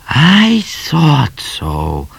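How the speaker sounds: high, extremely drawn-out sage drawl; wiser still. A narrator's reading. Cat answering Midnight's discovery, wiser still. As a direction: draw it out with lofty wisdom. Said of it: "more wisely still".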